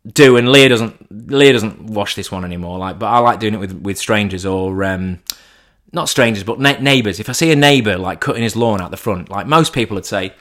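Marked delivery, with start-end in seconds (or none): none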